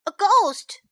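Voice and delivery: high, loud cry of alarm; sudden high-pitched alarm; startled cry. A narrator's reading. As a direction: cry out as if you've seen something scary. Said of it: "cried Sam".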